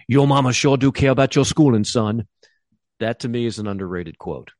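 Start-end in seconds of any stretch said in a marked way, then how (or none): 0.1-2.3 higher, louder put-on voice of a smug teacher; sly teacher voice. Quoting the teacher leaving the house to Forrest, higher louder smug voice. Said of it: "and he says".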